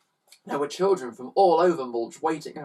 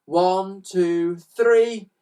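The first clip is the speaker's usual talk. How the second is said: louder and higher on each number; counting up; 6 semitones higher, 7 dB louder, much slower than usual. A narrator's reading. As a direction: build the count to a shout. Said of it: "Let's all try together".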